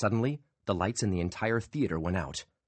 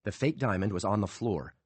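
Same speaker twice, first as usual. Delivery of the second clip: plain reading voice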